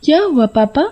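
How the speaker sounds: put-on high-pitched little child's voice; concerned child. Child asking papa why he is so nervous, concerned.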